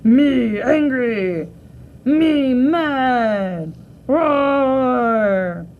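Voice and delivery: slow, loud, odd monster growl. Acted. A kid's film monster announcing its anger. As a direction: stomp it out like a cartoon beast.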